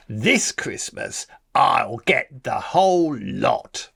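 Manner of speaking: put-on slow, savoured gloating boy; slow gloating vow. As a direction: relish every word of the vow. Repeated vow, slower.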